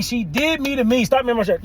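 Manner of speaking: normal talking voice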